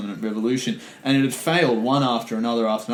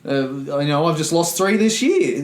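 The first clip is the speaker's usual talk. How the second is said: angry peasant retort; 6 semitones higher, 5 dB louder than usual. Retold or imitated. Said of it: "high horse and go".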